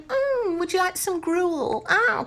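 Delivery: very high, slow falsetto; hammed-up squeaky gruel offer; mock air hostess. Hostess at the back of the plane, mock falsetto.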